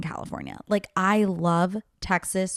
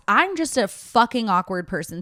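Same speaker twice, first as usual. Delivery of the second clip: ordinary talk, nothing marked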